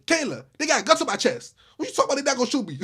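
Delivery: disbelieving outburst; exasperated. Retold or imitated. Retelling his heated reply.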